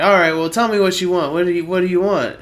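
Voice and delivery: fast, impatient and pressing; pushy rush. Retold or imitated. Guy pressing them to pick a drink, fast and pushy. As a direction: rattle it off, insisting.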